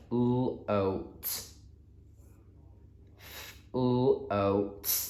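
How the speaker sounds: "L, oat" said sound by sound, softly. Shown as a demonstration. Teacher leading children through float, sounding out. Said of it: "let me do my sound buttons".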